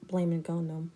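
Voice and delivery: ordinary talk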